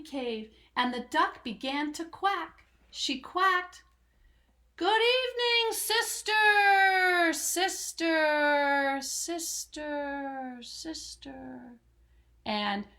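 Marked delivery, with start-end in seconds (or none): 4.8-11.8 high call fading away like an echo. Acted. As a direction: let each sister fade into the cave. Duck calling into cave, echo.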